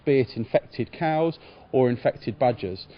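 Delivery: normal talking voice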